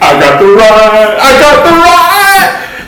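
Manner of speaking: slow high triumphant holler; excited shout. Retold or imitated.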